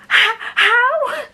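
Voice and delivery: high, stammered gasp of a word; baffled disbelief. Real, not acted. Reader stunned by Valkyrie showing up, baffled. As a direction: squeak it out, lost for words. Speechless at character's return.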